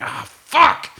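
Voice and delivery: high, sudden groan of dismay; a pained, high-pitched "ah, fuck"; imitating his own dismay at bad news; dismayed outburst. Retold dismay at MRI news.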